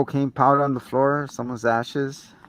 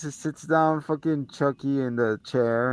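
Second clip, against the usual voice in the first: usual speaking voice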